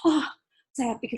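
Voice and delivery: ordinary talk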